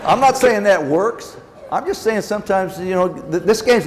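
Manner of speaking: laugh, then talk through it. Real, not acted. Laughs after Matt Kemp remark.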